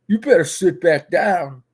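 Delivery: loud menacing order; angry threat. Retold or imitated. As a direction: threaten her to sit back down. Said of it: "he was so mad".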